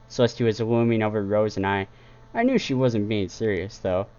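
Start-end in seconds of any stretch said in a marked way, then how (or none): none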